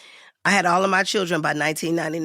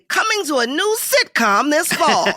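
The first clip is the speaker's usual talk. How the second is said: higher-pitched mock promo announcement; sitcom promo announcer; 7 semitones higher, 4 dB louder than usual. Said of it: "say their name and then say".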